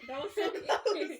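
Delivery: slow, loud laughing words; gasping out words through laughter. Real, not acted. Laughing after near burp.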